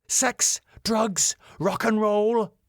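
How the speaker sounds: high-pitched, scandalised outrage; raging. Acted. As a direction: rage through each item separately.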